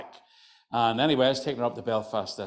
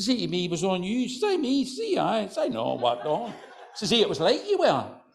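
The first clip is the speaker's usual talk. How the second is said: higher, broad rural Antrim brogue; old Glenarm men; 9 semitones higher, 4 dB louder than usual. Retold or imitated. Two old fellas chatting at the bridge corner, broad Antrim. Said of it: "two old boys stand at the corner".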